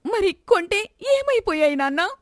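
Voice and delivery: a daughter confronting her gambling father; accusing daughter. Acted. Daughter voiced.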